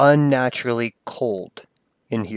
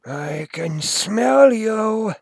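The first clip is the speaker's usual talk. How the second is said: high, slow whisper of a wind spirit; slow ghostly voice; 10 semitones higher, much slower than usual. Acted. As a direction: draw it out like a lurking spirit. Unseen voice threatens the player.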